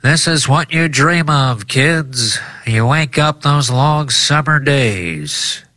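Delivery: high, slow nostalgic broadcaster; old-timey radio voice. Acted. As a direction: wax poetic like a vintage radio man. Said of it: "I'm gonna do an old-timey radio voice".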